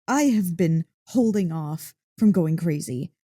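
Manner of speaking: usual speaking voice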